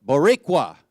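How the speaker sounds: loud, punchy one-word cheer; rallying call. Real, not acted. Speaker leading the congregation in saying it, rallying call. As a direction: call it out for a crowd to echo. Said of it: "everybody say it".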